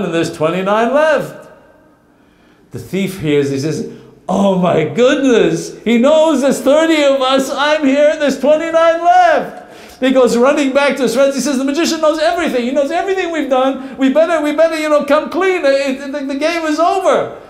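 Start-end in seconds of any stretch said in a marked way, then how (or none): 4.3-8.2 high, loud panicked gasp of realization; a thief who just got caught out, voice shooting up. Acted. 8.2-9.6 "twenty-nine left" blurted high, loud and slow in horror; the terrified realization of being counted; panicked thief. Acted. 11.6-17.4 high, fast, stumbling panic; breathless alarm to his friends; panicked report. Acted. Thief reports to gang.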